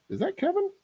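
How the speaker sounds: light up at a familiar name; real surprise spotting a friend in chat; surprised. Real, not acted.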